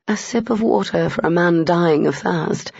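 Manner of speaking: usual speaking voice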